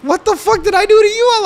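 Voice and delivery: high, loud shriek of fake betrayal; mock outraged shriek. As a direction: screech it, hurt and outraged.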